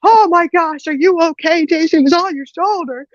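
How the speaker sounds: high, frantic mom imitation; mom panicking. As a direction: gush alarm in a sustained high mock-mom voice. Mom panics over bug on son.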